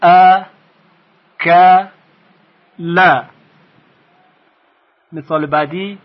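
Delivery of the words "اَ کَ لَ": ا، ک، ل as separate short "a", "ka", "la"; split into syllables. Shown as a demonstration. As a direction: model each short syllable for repeating, with long pauses. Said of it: "شما هم تکرار کنید".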